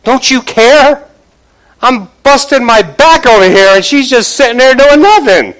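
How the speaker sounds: frustrated pleading cry; frustrated complaint. Retold or imitated. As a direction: whine it out like an overworked sister. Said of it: "Frustrated, speaking out of frustration".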